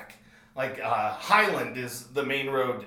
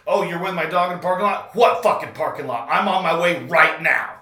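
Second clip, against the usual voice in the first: loud, higher, fast raging threats; furious shouting threats; 4 semitones higher, 8 dB louder, faster than usual. Retold or imitated. Threatening the prank callers on the phone, furious shouting. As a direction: roar it down the phone like you'll drive over and fight.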